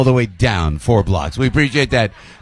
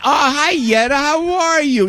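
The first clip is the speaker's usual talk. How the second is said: much higher, thrilled hello; host greeting a fan in the crowd, overjoyed; delighted greeting; 12 semitones higher, slower than usual. Real, not acted.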